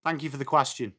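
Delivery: ordinary talk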